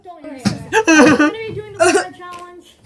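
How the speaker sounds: loud laughing announcement. Real, not acted. Laughing through intro.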